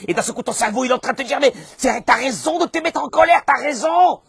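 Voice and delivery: high, loud agitated urging; heated egging-on. Acted. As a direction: shout it like anger justifying itself. Acts out brain urging anger. (excitation colérique, criée et aiguë)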